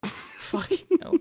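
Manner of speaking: breathy laugh through the words; laughing whisper. Real, not acted. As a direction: giggle it out under your breath. Laughing at the chaos.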